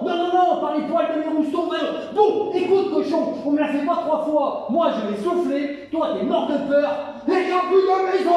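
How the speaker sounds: wolf losing patience, ending in a yell; exasperated wolf. Acted. Wolf rants at the third pig, rising to a shout.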